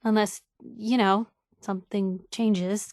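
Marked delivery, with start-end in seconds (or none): none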